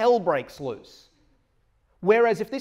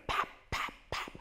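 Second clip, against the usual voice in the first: cap gun noise; 9 dB quieter than usual. Retold or imitated. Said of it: "they sounded like cap guns".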